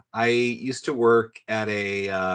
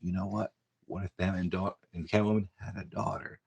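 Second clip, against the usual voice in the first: mock hushed pitch; 3 semitones lower, 6 dB quieter than usual. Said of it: "his one precious idea".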